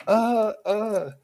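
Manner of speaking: high, loud mock yelps of alarm; startled yelps. Cross-dressing neighbor caught in his yard, imitated startled yelps. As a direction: stammer out two squealed uhs. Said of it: "And I startled him".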